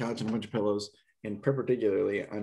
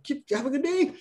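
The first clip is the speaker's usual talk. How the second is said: hasty goodbye; 4 dB louder than usual. Retold or imitated. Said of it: "And I'm like".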